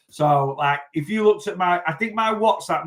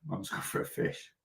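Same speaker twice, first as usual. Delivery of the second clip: quiet aside; 6 semitones lower, 12 dB quieter than usual. Retold or imitated. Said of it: "you wouldn't just call it a fish".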